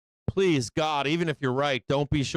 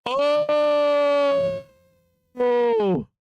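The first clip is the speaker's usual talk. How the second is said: high, loud surprised oh; surprised cries; 4 dB louder, much slower than usual. Real, not acted. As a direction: gasp out each oh at the chart. Reacting to the chart.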